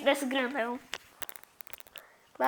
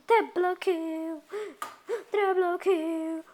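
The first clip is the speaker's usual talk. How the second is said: slow taunt; 6 semitones higher, much slower than usual. Acted.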